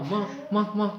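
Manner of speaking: ماہ called high and loud; companions shouting stop. Retold or imitated. Companions stopping the Bedouin in the mosque, loud call. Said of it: "صحابہ نے کہا،".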